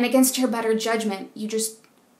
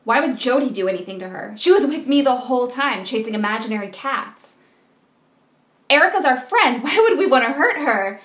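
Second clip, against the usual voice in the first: indignant protest; 3 semitones higher, 6 dB louder than usual. Acted. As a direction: defend your friend, voice rising in outrage. Defending friend against police suspicion.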